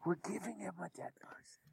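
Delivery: hushed, secretive whisper. Acted. Conspiratorial aside in game.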